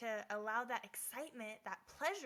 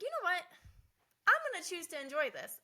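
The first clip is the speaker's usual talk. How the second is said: higher, louder burst of resolve; upbeat resolve; 7 semitones higher, 7 dB louder than usual. Retold or imitated. Recalling her choice while shoveling, upbeat resolve. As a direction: announce it like a new idea. Deciding to enjoy the snow.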